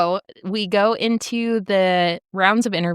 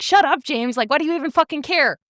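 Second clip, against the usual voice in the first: higher and fast, snapping back at him in a retelling; mock angry yell; 8 semitones higher than usual. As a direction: fire it back at James, quicker and higher. Ruby retold yelling back at James.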